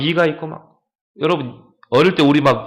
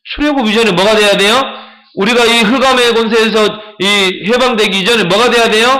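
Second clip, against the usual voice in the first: louder, higher rhetorical questions; forceful preaching; 6 semitones higher, 5 dB louder than usual. Acted. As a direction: drive the question at the crowd. Preacher questioning congregation loudly.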